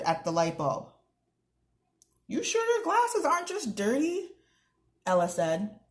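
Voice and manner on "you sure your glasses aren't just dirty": put-on high, cheeky girl's voice; a girl mocking a grown-up with a grin; teasing girl. A narrator's reading. Ella teases Mister Mattal about his glasses, high and cheeky.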